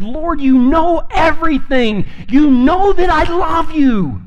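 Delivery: high, slow, strained plea; Peter, hurt, answering Jesus the third time; hurt pleading. Acted. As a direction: beg him to believe you. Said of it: "Peter was hurt".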